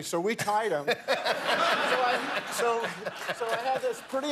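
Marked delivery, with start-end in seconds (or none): 0.9-1.9 laughing. Real, not acted. Laughing at the tie.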